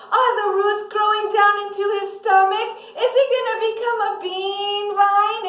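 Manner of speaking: acted panic. Panicking mother finds leaves on baby.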